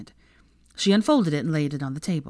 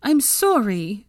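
higher, louder, drawn-out apology; anxious and pitched up; panicked apology; 5 semitones higher, 5 dB louder, much slower than usual. A narrator's reading. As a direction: apologise in alarm. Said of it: "said Lavender hurriedly, looking panicked".

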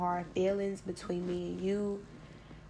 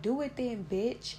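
a higher, challenging snap back; defiant dare; 4 semitones higher than usual. Retold or imitated. Speaker dares the man who threatens to shoot her, defiant. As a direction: dare him to do it, fearless and scornful.

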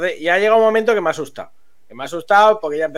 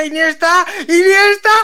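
higher, louder rising calls of the name; excited calling; 10 semitones higher, 5 dB louder than usual. Retold or imitated. Friends watching the final calling Iniesta, excited. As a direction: call out as the shot comes.

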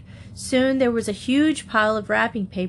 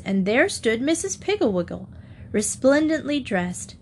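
plain reading voice

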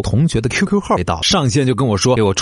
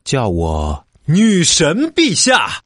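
haughty goddess; 5 semitones higher, much slower than usual. Acted. Demanding to be called goddess.

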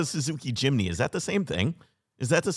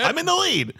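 gleeful brag; 3 dB louder than usual. Real, not acted. Bragging while ahead.

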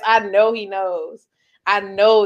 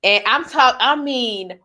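usual speaking voice

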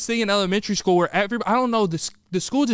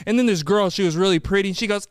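ordinary delivery

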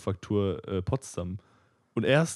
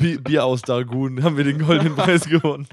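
proud announcer; 4 semitones higher, 8 dB louder than usual. Pretend. Mock proud brewery announcement.

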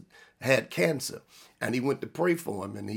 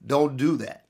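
normal talking voice

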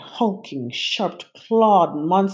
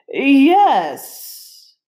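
louder, higher monster hiss; 9 semitones higher, 8 dB louder than usual. A narrator's reading. Vladimir answering the narrator, hissing. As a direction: let it slither out. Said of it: "he hissed".

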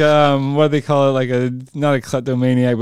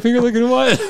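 higher, giggling question; laughing confusion; 8 semitones higher than usual. Retold or imitated. Hero baffled by last words, laughing. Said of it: "you're like".

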